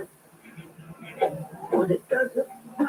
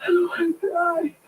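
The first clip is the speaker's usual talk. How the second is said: crying apology; 7 semitones higher than usual. Acted. Dying man begging in car.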